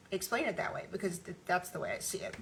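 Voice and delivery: tired, shaky voice. Real, not acted. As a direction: let the voice shake from tiredness. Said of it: "if I'm sound a little shaky in my voice".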